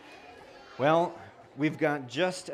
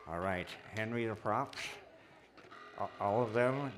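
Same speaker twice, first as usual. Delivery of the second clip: muffled around a party horn; party blower in mouth; 2 semitones lower, 3 dB quieter than usual. Real, not acted. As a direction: talk through a horn in the mouth. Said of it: "Little Mermaid party horn in his mouth".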